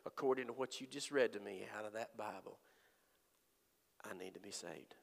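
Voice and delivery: whispered, soft and low confession; faint whisper. Retold or imitated. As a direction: breathe it out like a dying man. Dying man's quiet confession.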